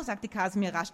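plain reading voice

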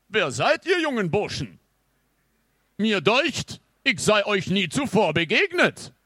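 high, loud, pompous knight; old-time knight. Acted. Mummified knight questions the young lads, loud and high. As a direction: demand it loudly like an affronted old nobleman. Revived knight addresses the boys.

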